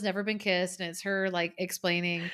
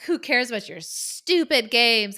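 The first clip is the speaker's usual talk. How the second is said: retold yell; 6 dB louder than usual. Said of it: "she yells at all of them".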